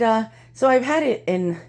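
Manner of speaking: ordinary talk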